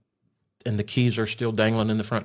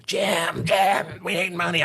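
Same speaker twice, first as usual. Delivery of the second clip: higher, mock frantic wife on the phone; mimicking screaming wife; 9 semitones higher, slower than usual. Wife on the phone demanding money, mock shrill scream. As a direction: play the wife shrieking for money, half-mocking. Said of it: "she's screaming about money".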